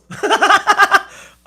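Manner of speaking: real laugh, loud and high; a big high laugh; high burst of laughter. Real, not acted. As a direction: break up laughing mid-sentence. Laughs mid Spanish order.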